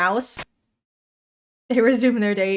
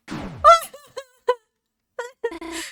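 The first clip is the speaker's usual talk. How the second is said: mock sob; 12 semitones higher than usual. Whining at game enemies.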